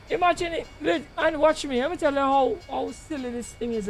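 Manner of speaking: normal talking voice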